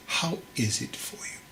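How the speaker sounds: whispered gently to the student; hushed whispered question; soft whisper. Acted. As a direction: breathe the question, barely voiced. Monk asks softly.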